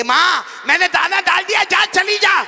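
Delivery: shouted high and loud, dismissive; rude shout. Retold or imitated. Imagined rude son shouting at his old mother.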